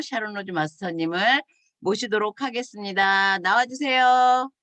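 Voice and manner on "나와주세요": louder, slow host call to the stage. Real, not acted. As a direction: draw it out to welcome the guest up. Host calls speaker onstage. (사회자가 연사를 무대로 부르며 크고 느리게 외치기)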